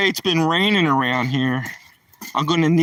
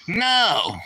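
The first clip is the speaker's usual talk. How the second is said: higher, drawn-out mock refusal; retold cheeky "no"; defiant kid's no; 5 semitones higher than usual. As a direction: refuse bedtime like a wise-guy kid. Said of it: "I used to tell my parents".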